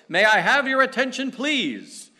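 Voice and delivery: loud, high call for attention over a crowd; loud announcement. Retold or imitated. Man on the subway calling for attention, loud and high. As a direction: announce it to the whole train. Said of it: "announced in a very loud voice".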